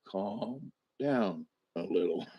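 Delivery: soft, slow calm down to a fussing friend; soft soothing. Retold or imitated. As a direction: hush her gently. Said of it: "And I'm like".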